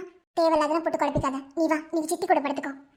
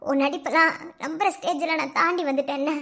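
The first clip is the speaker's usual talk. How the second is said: ordinary talk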